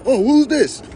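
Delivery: high, loud intrigued question; mock eager curiosity. Someone browsing the catalog of Egyptian deities, high and eagerly curious. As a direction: pipe up high with sudden interest.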